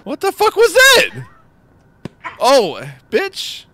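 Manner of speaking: high, loud shocked cry; startled shriek. Real, not acted. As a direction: shriek it in sudden alarm. Startled by game event.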